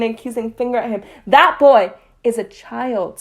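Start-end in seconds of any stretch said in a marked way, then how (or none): 1.3-3.2 firm interruption. A narrator's reading. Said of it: "Snape interrupted".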